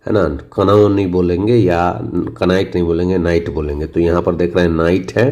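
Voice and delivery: ordinary talk, nothing marked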